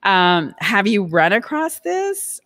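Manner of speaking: normal talking voice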